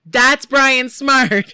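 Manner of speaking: loud, slow drawn-out yell; slow booming shout of the name. Retold or imitated. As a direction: bellow the name across the bar. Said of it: "yelled to the bartender".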